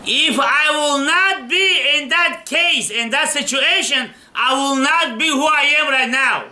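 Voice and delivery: slow, drawn-out cry, high and ringing; impassioned declaration. Retold or imitated. Rabbi tells his weeping father-in-law, passionate slow outcry. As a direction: proclaim it slowly like a rabbi who has no regrets.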